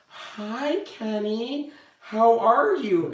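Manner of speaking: high, slow sing-song hello like to a fragile patient; careful greeting. Retold or imitated. Coworkers greet confused Kenny, high, slow and careful. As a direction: coo it up high and slow, tiptoeing. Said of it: "this is how they would treat me".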